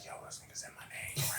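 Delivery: very soft whispered aside, retold; stunned whisper. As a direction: whisper it as a secret, amazed thought. Stunned private thought on being called.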